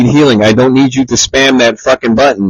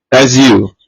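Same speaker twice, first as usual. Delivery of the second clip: ordinary talk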